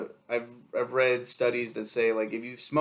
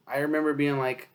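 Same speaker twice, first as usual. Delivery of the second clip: ordinary talk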